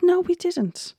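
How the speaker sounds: pitched well up, quoting the group's answer; a light, high imitation of their denial; high-pitched retold reply. Retelling the team's answer about the logo, high mimicry.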